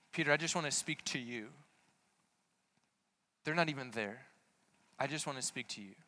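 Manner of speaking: soft, tender invitation; Jesus quietly singling out Peter; gentle intimate voice. Acted. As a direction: speak softly, one to one.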